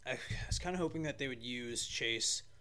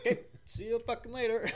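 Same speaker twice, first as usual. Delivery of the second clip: cheeky goodbye; 12 semitones higher, 5 dB louder than usual. Retold or imitated. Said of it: "He's like".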